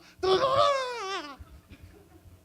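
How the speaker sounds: very high, loud revving noise; chainsaw sound effect. Acted. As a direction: imitate a chainsaw starting. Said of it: "I need to think of a better sound effect".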